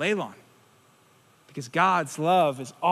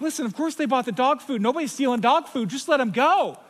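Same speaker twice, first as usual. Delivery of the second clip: higher-pitched exasperated mock rant; retelling an impatient grumble, voice pitched up; acted-out irritation; 6 semitones higher than usual. Griping from the checkout line at the receipt checker, acted-out irritation. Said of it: "I was getting so mad at this guy".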